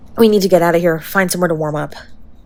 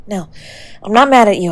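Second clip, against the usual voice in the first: normal talking voice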